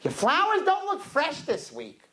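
high, drawn-out complaint; complaining customer. Retold or imitated. Customer faults the Korean florist's flowers, high mock complaint. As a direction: needle the florist in a high, slow whine. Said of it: "One of them's like".

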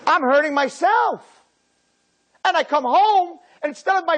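high, loud cry; child's protest shout. Retold or imitated. Child shouting back at parents, high and loud. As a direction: cry out that it's your own pain.

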